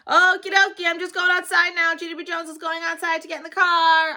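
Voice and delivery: high, loud shout; a child shouting to be noticed. Acted. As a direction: bellow it for the whole house to hear. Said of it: "I shouted louder".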